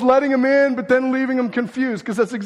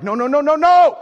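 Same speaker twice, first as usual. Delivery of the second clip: rising to a loud, higher shout of no; calling out; 6 semitones higher, 7 dB louder than usual. Retold or imitated. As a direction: shout them back from the wrong path. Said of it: "and you're like".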